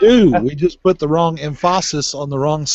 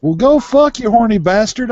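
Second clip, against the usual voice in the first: higher, louder mock yell at crickets; mock angry yell; 10 semitones higher, 6 dB louder than usual. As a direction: bark it at the crickets. Yelling at chirping crickets.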